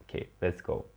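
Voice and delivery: ordinary talk, nothing marked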